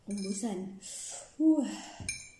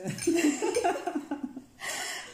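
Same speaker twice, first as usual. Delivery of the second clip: high giggle; 9 semitones higher, 4 dB louder than usual. Real, not acted. Nervous laugh before spicy challenge.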